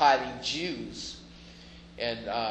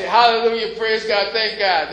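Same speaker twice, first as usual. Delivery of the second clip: higher, louder hallelujah, imitating joyful believers; hammed-up churchy praise, thrown up loud; mock praise shout; 7 semitones higher, 9 dB louder than usual. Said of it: "y'all say".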